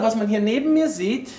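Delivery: ordinary talk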